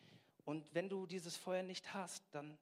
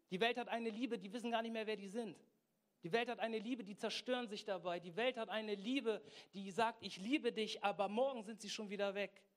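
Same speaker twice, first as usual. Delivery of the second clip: loud, high, rousing sermon; preaching at full force; 6 semitones higher, 6 dB louder than usual. Real, not acted. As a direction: fire up the congregation with raised voice. Rallies congregation at full force. (feurige, laute Predigt)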